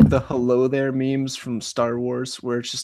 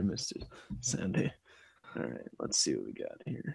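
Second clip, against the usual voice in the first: whisper; 7 semitones lower, 14 dB quieter than usual. Real, not acted. Muttered aside.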